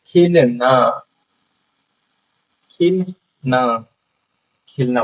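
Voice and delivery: खिलना with a long stretched final ना; teacher modelling खिलना with a drawn-out ना. Shown as a demonstration. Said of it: "आ की मात्रा लगा दें".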